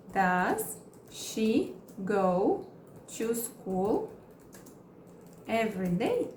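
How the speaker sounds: very slow, chunked with pauses; slow broken-up teaching read; slow model question. Shown as a demonstration. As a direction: stretch it out for learners. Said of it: "Agora eu quero perguntar".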